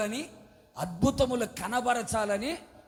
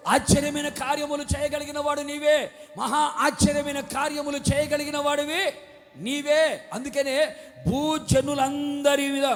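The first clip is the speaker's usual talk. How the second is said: louder, ringing pulpit declaration; preaching at full force; 2 semitones higher, 4 dB louder than usual. Acted. Preacher blessing his congregation, loud fervent proclamation. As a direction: proclaim each blessing over the crowd.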